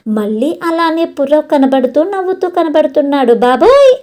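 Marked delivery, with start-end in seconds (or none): 3.3-3.9 loud, high cry of alarm; startled girl shouting on seeing him. Acted. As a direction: yelp out in fright. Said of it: "ఈసారి ఇంకా గట్టిగా అరుస్తుంది".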